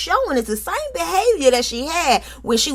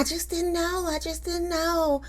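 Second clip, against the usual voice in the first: high, much softer, whiny mumble; mock whiny Tisha; 7 semitones higher, 11 dB quieter, slower than usual. Host mocking Tisha's apology, high and hushed. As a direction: whimper it like a weak excuse. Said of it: "finally apologizing".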